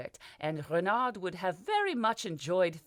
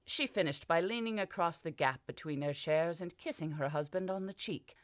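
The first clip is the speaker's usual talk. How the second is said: usual speaking voice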